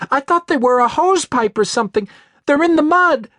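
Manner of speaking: high, loud hysterical rush; near-hysterical terror; panicked. Acted. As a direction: voice breaking with horror. Describing gutted man, panicking.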